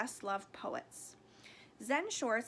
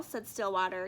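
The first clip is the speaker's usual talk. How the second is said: usual speaking voice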